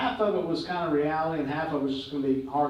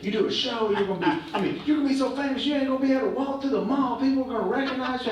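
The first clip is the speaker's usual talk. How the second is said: high, fast hype-man pitch; fast-talking LA guy; 9 semitones higher, faster than usual. Retold or imitated. LA producer hyping Willie on the phone, fast excited pitch. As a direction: rattle it off like a pushy LA producer. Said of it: "he's talking real fast".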